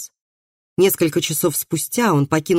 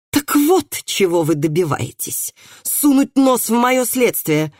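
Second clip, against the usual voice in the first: higher-pitched, moderately angry outburst; angry bark; 6 semitones higher than usual. A narrator's reading. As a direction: snap at them in indignation, stretching the last words. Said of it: "рявкнул судебный пристав".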